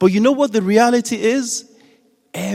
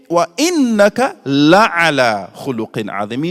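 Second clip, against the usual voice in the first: Quran recitation; 3 semitones lower, much faster than usual. Acted.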